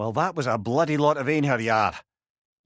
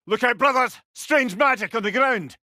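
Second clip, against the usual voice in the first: warning call; 8 semitones higher, 3 dB louder than usual. Acted. Warning companions in battle.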